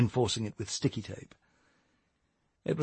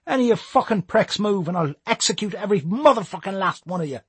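movie gangster threat; 8 semitones higher than usual. Acted. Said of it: "start quoting Pulp Fiction".

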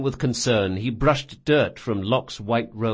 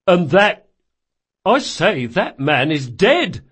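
loud, raised exclamation; startled sergeant; 6 semitones higher, 7 dB louder, slower than usual. Acted. Watch sergeant spotting the corpse, loud startled voice. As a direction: announce it in shock.

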